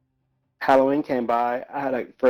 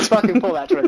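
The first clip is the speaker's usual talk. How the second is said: higher, louder taunt through laughter; laughing taunt; 10 semitones higher, 5 dB louder than usual. Retold or imitated. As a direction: egg him on while cracking up.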